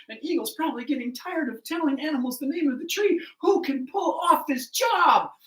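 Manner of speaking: high, loud complaint building to a shout; exasperated crowd. Acted. Animals wonder who is left, high and exasperated. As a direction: throw the final question out in exasperation. Animals despair of finding anyone.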